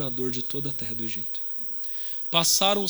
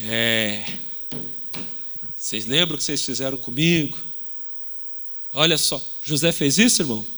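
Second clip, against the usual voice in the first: mocking taunt; 5 dB louder than usual. Childish José taunting brothers.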